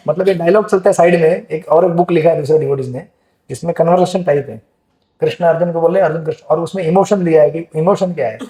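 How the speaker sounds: ordinary talk, nothing marked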